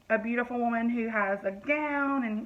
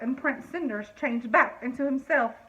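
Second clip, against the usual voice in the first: usual speaking voice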